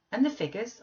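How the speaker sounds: bright, eager question; encouraging cry. Acted. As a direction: call it out encouragingly. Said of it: "I cried encouragingly".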